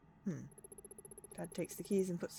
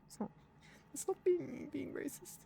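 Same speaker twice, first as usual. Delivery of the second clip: usual speaking voice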